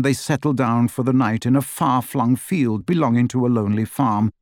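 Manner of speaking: usual speaking voice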